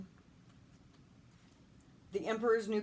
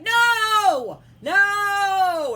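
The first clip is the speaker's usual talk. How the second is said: long, high screamed "no"; hammed-up horrified scream; retold tantrum; 13 semitones higher, 14 dB louder than usual. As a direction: wail it at full volume, imitating a meltdown. Re-enacting friend's tantrum on floor.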